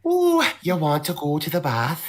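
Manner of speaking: teasing imitation of a Yorkshire accent. Pals joking about accents, mock Yorkshire impression. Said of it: "Yorkshires talk like this".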